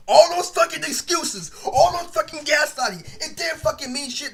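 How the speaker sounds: high, loud, furious burst; real anger spilling over; angry outburst. Real, not acted. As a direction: blow up over the excuses. Angry rant about Justin's excuses.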